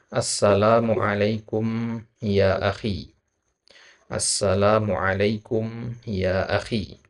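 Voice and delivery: slow, careful Arabic greeting for students; drawn-out model phrase to repeat; slow model reading. Shown as a demonstration. Teacher reads the greeting slowly for students.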